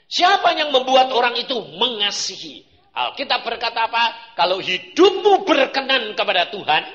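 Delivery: loud fervent sermon voice; preaching at full force. Acted. Preacher to the congregation, preaching loud and fervent.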